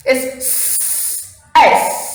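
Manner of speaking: "S" as an unvoiced hiss; hissed s sound. Shown as a demonstration. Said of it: "S sounds s".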